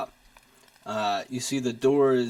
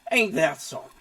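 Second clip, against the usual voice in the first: Southern drawl; 4 semitones higher, faster than usual. Acted. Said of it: "I can't do it with Aurelius".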